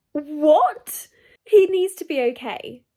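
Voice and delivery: loud, high alarmed cry; alarmed shock. Real, not acted. Reader hearing a hero was shot, alarmed and loud. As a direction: react in panic. Reacting to shooting.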